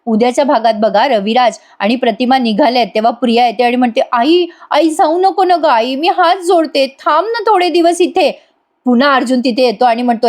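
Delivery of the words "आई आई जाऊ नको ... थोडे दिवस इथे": high, drawn-out begging; acted plaintive whine, slow and high; pleading. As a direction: beg your mother not to go. Priya begs her mother to stay.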